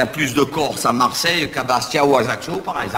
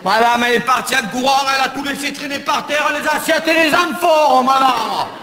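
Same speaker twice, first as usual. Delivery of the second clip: voix perchée très haute, appel plaintif; voix de femme aiguë criarde; 10 semitones higher than usual. Acted. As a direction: crie comme une voisine affolée. Imite une femme qui crie. (imitation aiguë d'une commère qui appelle madame)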